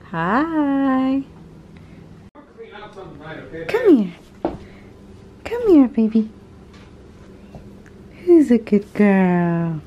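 high sing-song pet voice. Real, not acted. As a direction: coo at the puppy, high and bright. Greeting the new puppy.

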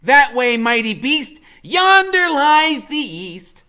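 high, loud, slow mock-archaic; Freak's grand voice. A narrator's reading. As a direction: proclaim it like a questing knight. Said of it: "he says".

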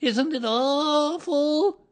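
high, loud, slow wail; long drawn-out squeal of horror; frightened Piglet. A narrator's reading. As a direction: moan in dread as a tiny animal. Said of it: "said Piglet".

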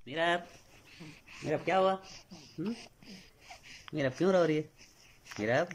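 high gentle coaxing. Real, not acted. Father soothes his crying baby daughter, high coaxing baby talk.

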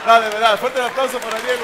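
loud, high rallying shout to the crowd; calling for applause. Real, not acted. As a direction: whip up the audience at full volume. Calls for applause over crowd.

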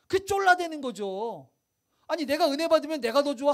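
ordinary talk, nothing marked

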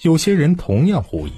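ordinary delivery, nothing marked

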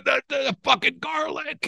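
high strained yell; retold desperate shout; choked shout. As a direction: blurt it out half choking. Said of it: "I go".

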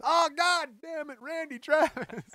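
high, loud, slow mock exasperation; mock frustrated curse. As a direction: curse the rival in hammed-up fury.

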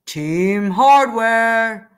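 grand announcement. Acted. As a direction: announce the team like a title. Team name announced.

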